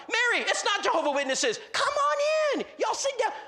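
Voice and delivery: very high, eager welcoming shout; hammed-up excited yelling; excited husband. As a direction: imitate a thrilled man hollering guests inside. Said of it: "Oh, this is exciting".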